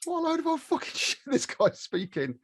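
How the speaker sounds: high, incredulous retold reaction; can't believe the rubbish being heard; jaw-dropped disbelief. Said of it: "with my jaw on the floor thinking".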